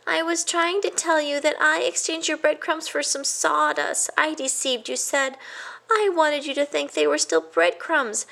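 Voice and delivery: high, earnest mouse voice; confessing mouse. A narrator's reading. A guilty mouse confesses to his cousin, high.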